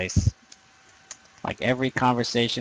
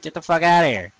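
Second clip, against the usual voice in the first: snapping at invaders, a bit louder; mock dismissal; 5 semitones higher, 5 dB louder than usual. Retelling what he says to game invaders, mildly louder. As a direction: brush the invader off with a mild mock bark. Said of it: "It's like".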